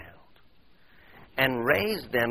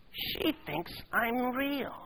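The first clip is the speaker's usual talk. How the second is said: higher still and very slow, softer; slow, wondering, softer repeat; hushed awe; 11 semitones higher, 5 dB quieter, much slower than usual. Acted. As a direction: savour it in disbelief. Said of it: "with astonished elation".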